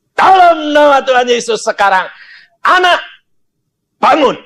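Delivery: high, loud command in Jesus' name; commanding prayer. Retold or imitated. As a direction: raise the dead child with authority. Pastor commands child to rise.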